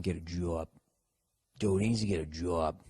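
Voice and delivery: low, soft Boston accent on "job". Shown as a demonstration. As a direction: stretch "job" into a Boston vowel. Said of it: "working on your Boston accents".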